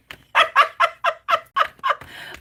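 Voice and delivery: a high, bright burst of laughter; quick high giggle. Real, not acted. Laughs at her own quickness.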